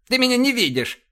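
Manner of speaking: high, indignant bark; angry bark. A narrator's reading. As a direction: snap it back, pitched high. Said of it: "рявкнул он". (сердитый рявк)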